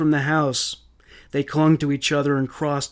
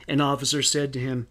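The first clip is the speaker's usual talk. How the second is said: ordinary delivery, nothing marked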